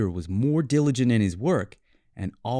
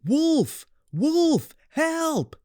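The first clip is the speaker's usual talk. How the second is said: shouting for help; much slower than usual. A narrator's reading. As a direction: yell it out to fool the village. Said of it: "he shouted at the top of his lungs".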